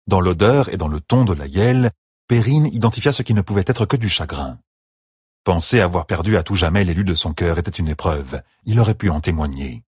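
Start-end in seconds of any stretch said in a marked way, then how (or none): none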